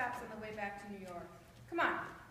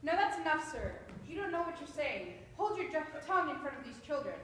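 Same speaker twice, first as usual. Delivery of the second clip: firm, louder reprimand; stern scolding; 5 semitones higher, 5 dB louder than usual. Acted. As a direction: put him in his place. Agent scolds the drunk.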